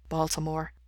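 softly spoken reply. A narrator's reading. Bandmate completing a call and response, softly. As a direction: answer quietly, voice down. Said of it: "Mikey replies softly".

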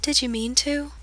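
put-on solemn girl asking about his death, softly; questioning sad girl. A narrator's reading. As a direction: ask gently. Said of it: "tilts her head to one side questioningly".

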